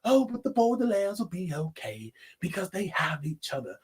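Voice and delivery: whispered, syrupy happy ending; mocking the movie's soft happy ending in a whisper; mock soothing whisper. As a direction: breathe it like a sappy reassurance. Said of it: "making an ending where it's just like".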